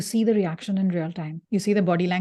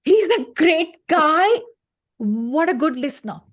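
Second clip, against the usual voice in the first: mock gushing praise; 7 semitones higher, 7 dB louder than usual.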